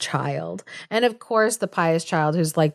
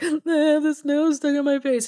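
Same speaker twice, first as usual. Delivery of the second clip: put-on high, whiny voice of a greedy girl, pitched way up; whining girl; 8 semitones higher than usual. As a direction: whine it like a spoiled girl in distress. Greedy girl wails about nose.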